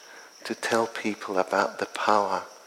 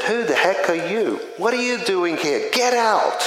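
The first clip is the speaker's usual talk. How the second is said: angry yell; 9 semitones higher than usual. Retold or imitated. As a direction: drive the stranger away, yelling. Angry tenant yelling through screen.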